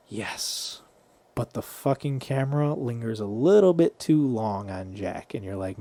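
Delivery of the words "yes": whispered audience reaction; soft, low, voiceless yes; hushed yes. Retold or imitated. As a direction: whisper a private little cheer. Audience member's hushed reaction, retold.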